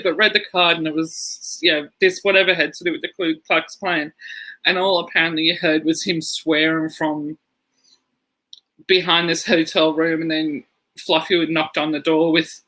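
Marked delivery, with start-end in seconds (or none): none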